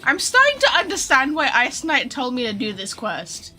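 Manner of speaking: ordinary talk, nothing marked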